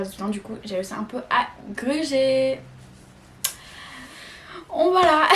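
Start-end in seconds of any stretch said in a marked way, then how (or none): 4.7-5.4 laughing. Real, not acted. Giggles after admitting she cheated.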